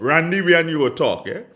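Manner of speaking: mock warning. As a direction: bark a mock warning at the bandleader. Retelling a judge's warning to bandleader.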